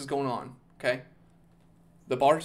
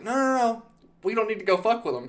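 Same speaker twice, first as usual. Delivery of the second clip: urgent plea; 7 semitones higher, 5 dB louder than usual. Retold or imitated. Holding his friend back.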